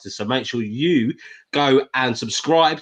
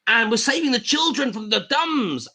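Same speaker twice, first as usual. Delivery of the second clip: high, drawn-out mock plea; crusading child-saver; 9 semitones higher than usual. Quoting her to show why people believe, high mock plea. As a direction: imitate an impassioned appeal for the children. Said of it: "because she says".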